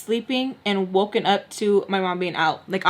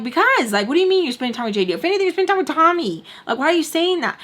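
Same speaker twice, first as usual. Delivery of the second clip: high, fast indignant protest; retold quarrel, pitched up and rushing; defensive arguing back; 7 semitones higher, faster than usual. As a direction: act out a heated comeback.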